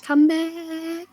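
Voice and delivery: high, drawn-out summoning call; playful calling out. Pretend. Game master calling muted players back, playful high call. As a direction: call them back from afar without shouting.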